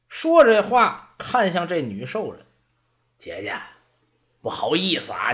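a hint of a grumbling old man; lightly voiced old servant. Old servant brushing off the kid, then apologizing to the orc. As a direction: shrug off the fuss, then turn polite.

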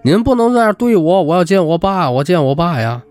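high wail easing down in pitch; mock tearful plea. A narrator's reading. Student being taken away, begging to see dad, mock tearful plea. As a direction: plead as if crying, lightly hammed up. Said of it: "他还哭着说".

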